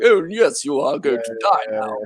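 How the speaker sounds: stock British movie baddie, lightly put on; British villain. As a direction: toss it off like a cartoon British villain. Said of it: "Usually all the bad guys are British".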